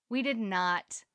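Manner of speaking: ordinary delivery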